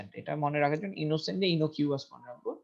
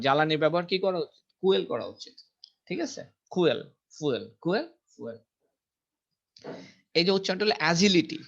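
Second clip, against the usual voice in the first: ordinary talk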